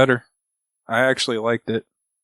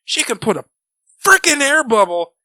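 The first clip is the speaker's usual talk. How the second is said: high, worked-up mock outburst; exasperated rant; 13 semitones higher than usual. A fan ranting back at Reed, climbing high and indignant. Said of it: "I'm like".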